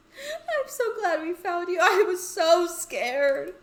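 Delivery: high, loud, slow tearful outburst; tearful relief. Acted. Misha hugging found friend, tearful relieved outburst. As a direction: pour out relief through tears. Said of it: "Is she crying?".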